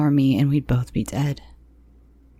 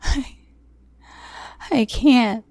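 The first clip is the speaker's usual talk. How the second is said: high, pleading cry; desperate tearful refusal; begging; 9 semitones higher, slower than usual. Acted. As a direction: beg him, voice breaking high. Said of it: "I begged".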